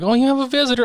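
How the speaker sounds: high sweet announcement; bright high mock nurse; sing-song nurse. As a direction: lilt it up like a nurse. Nurse voiced.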